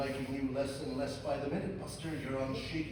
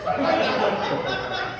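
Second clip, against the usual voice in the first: laughing; 7 semitones higher, 12 dB louder, much slower than usual. Acted. Mocking the voice, laughing.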